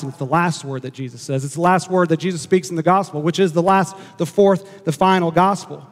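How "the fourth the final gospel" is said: ordinary talk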